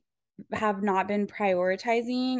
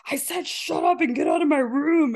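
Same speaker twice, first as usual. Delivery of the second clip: mock angry sister; 5 semitones higher, 5 dB louder than usual. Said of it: "And she would be like".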